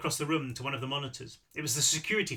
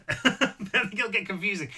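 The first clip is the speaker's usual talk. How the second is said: laughing aside; 4 semitones higher, 4 dB louder than usual. Real, not acted. Narrator laughing about his accents.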